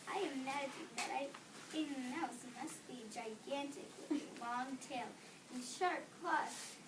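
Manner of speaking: ordinary delivery, nothing marked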